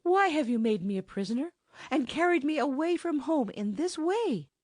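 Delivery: high, pleading rush. A narrator's reading. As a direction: beg him to let her go, voice pitched high. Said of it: "turned pleadingly to Dragonfell".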